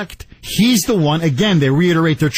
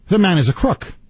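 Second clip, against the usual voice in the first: ordinary delivery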